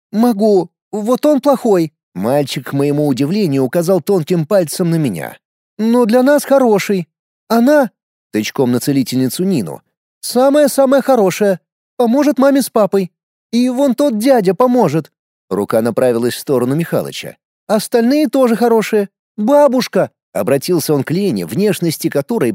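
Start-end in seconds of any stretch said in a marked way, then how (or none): 0.1-1.9 put-on child voice. A narrator's reading. Little boy speaks. 5.8-7.9 put-on high little boy's voice; a small boy judging people; child voice. A narrator's reading. 10.2-15.1 put-on child voice. A narrator's reading. Little boy speaks. 17.7-20.1 put-on child voice. A narrator's reading. Little boy speaks.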